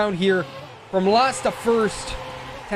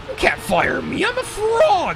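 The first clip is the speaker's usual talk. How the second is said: whispered start, then a sudden squeaky high frog cry; a goofy, self-admittedly failed cartoon-frog impression; breathy lead-in jumping to a very high silly yelp; Suction Cup Man; 13 semitones higher than usual. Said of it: "I can't do the Suction Man Cup voice".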